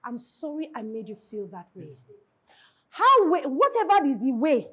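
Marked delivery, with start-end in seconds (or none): 0.0-1.9 gentle apology. Shown as a demonstration. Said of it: "the first general one is that".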